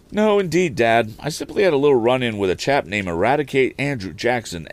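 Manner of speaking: put-on an excited young man back from a ride; eager young Tom. Tom answers father, voiced.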